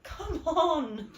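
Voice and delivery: loud, high-pitched in-character yell; mock-frustrated "come on"; acted exasperated shout. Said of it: "She's just gonna go like".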